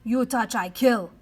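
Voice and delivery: loud, fierce threat; little brave growling a death threat; ferocious growl. A narrator's reading. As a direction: growl it like a tiny warrior. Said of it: "the Indian growled, growled ferociously".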